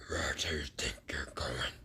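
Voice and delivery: soft, breathy threat; menacing whisper. Acted. Boruto stopping the fleeing villain, whispered menace. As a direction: whisper it like a predator.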